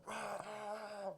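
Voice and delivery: high, hushed "rah" of a crowd; imitated crowd roar. Retelling the crowd after a knockout, imitated crowd roar. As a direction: stadium eruption done softly. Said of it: "just hear the".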